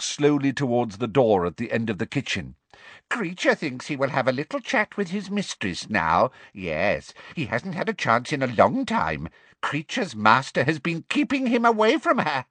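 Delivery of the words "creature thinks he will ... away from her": house elf voice. A narrator's reading. Elf talks of himself.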